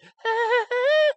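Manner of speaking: high, loud creepy cackle; shrill laugh. Pretend. Imitating the otter laughing in the air ducts, shrill giggle. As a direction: cackle shrilly like a demented child. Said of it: "like, a shrill".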